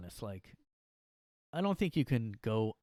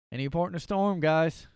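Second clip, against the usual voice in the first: called out; 5 semitones higher, 7 dB louder than usual. Acted. Punchline called out to guys.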